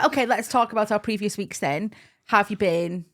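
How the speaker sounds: ordinary talk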